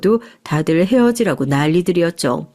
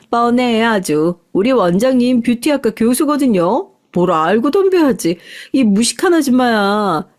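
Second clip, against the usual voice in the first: higher, jeering acted voice; mocking salon staff; 3 semitones higher than usual. Salon staff laughing at the future mother-in-law, mocking and indignant. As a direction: scoff on behalf of your boss. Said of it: "마음껏 비웃었습니다".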